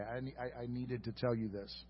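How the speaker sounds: ordinary talk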